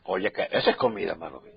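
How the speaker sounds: soft, low, touched remark; quiet moved aside. Real, not acted. Pastor softly tells church he is still moved. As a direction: hush down, moved by yesterday's word.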